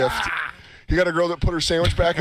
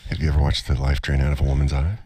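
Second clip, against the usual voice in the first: deep, menacing psycho voice; a serial killer savouring each word; creepy killer voice; 14 semitones lower, faster than usual. Acted. Creepy killer quote in a bit.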